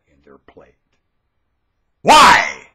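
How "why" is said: high, loud single cry; shouted demand. Real, not acted. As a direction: fire the word out loudly.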